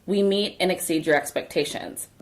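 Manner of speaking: ordinary talk, nothing marked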